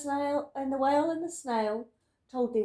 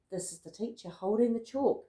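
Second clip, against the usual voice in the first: plain reading voice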